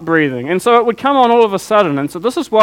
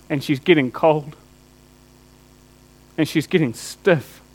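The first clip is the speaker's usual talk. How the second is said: hushed grief; 4 semitones lower, 6 dB quieter than usual. Real, not acted. Holding dead daughter.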